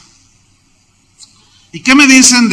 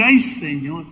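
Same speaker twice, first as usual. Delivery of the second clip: dismayed sigh; 4 semitones lower, 5 dB quieter, slower than usual. Retold or imitated. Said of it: "yo dije".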